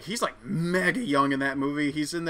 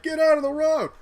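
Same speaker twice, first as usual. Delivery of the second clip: high loud yelling driver; hammed-up angry driver; mock yell; 11 semitones higher, 5 dB louder than usual. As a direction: shout at the guy in the road.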